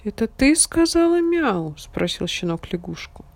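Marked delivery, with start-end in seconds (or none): 0.0-1.7 puppy voice. A narrator's reading. Puppy asks frog.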